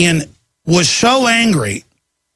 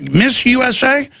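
mock leer; 7 semitones higher than usual. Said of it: "They're like".

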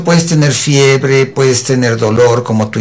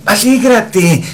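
slow, higher, drawn-out coaxing; mock cheering-up; 6 semitones higher, slower than usual. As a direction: imitate someone urging a patient to cheer up. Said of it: "Y luego les dicen". (ánimo exagerado, alargando las palabras)

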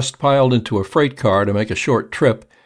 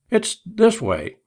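hesitant, slow; 12 semitones higher, slower than usual. A narrator's reading. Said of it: "began Turkey Neck again".

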